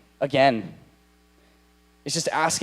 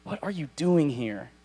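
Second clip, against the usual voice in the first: usual speaking voice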